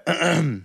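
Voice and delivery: mimicked awkward throat clear; short throat clear opening a retold reply; imitated throat-clearing. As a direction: clear your throat as she did before speaking. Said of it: "And then she was like".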